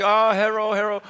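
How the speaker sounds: "hello" with R for L in a mock Chinese accent; eager mock Chinese-accented "hello", the L turned into R. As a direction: play a learner with a Chinese accent saying "herro". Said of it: "I would just be like".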